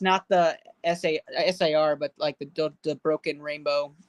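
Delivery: normal talking voice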